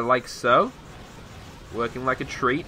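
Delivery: normal talking voice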